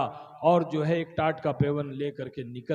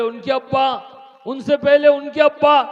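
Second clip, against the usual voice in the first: loud pressing question; 9 semitones higher, 11 dB louder than usual. Acted. Fakir presses the king.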